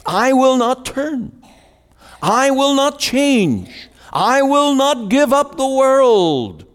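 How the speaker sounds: stubborn refusal. Retold or imitated. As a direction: voice the unrepentant sinner digging in his heels. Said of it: "Men and women who said".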